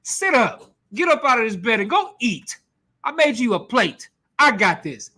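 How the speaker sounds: a hint of a stern wife shaking him up; Jezebel scolding. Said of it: "She's like".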